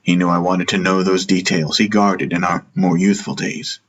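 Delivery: ordinary delivery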